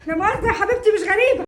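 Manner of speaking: high, agitated aside to a guest. Acted. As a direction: apologise while still worked up from the row. Acted apology to guest mid-quarrel.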